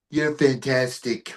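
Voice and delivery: slow, drawn-out praise in a put-on celebrity voice; a light mock impression of a famous politician; imitating Trump. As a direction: lean back and drawl the compliment slowly. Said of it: "and he goes".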